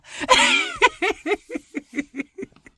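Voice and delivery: a loud high laugh. Real, not acted. Laughing at punchline.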